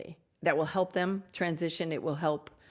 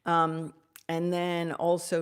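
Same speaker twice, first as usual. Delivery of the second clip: ordinary talk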